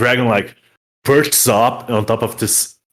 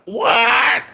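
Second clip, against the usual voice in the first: squeaky high, confused cry; a doddering kobold who can't hear; old kobold sorcerer answering the dragon in confusion. Acted. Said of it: "approaches the dragon, like".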